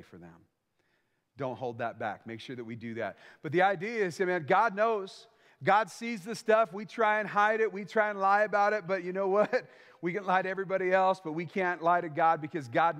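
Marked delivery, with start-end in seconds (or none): 4.2-8.9 preaching emphasis. Acted. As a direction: lean hard on each word about God.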